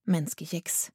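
ordinary delivery, nothing marked

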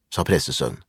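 ordinary delivery, nothing marked

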